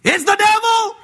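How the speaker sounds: hammed-up terror of churchfolk at a miracle; mock panicked shout. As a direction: yell it like you've seen the devil. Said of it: "The Lord comes walking by".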